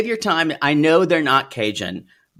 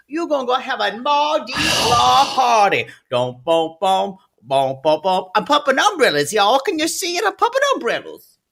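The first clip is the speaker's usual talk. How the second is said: hammed-up Cajun accent; 7 semitones higher than usual. Cohost hyping Kenner, Louisiana in a Cajun accent. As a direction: sell the Louisiana trip in a big Cajun accent. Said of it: "But I'm still gonna do a Cajun accent".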